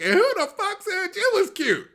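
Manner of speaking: high, loud mocking yell of a heckler; shrill heckle thrown from the crowd. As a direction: squeal it out like the drunk heckler. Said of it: "this chick yells out".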